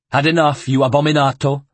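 loud, sneering taunt; a bully gloating over a beaten man; gloating guard. Acted. The guard gloats over the dragged monk, loud and cruel.

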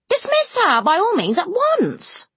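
shrill lady dismissing the impostor; crying out. A narrator's reading. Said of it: "cried Lady Ingram".

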